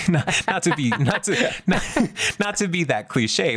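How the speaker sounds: words broken up by real laughter; can't get the words out for laughing; laughing through it. Real, not acted. Laughing mid-sentence at Sindel.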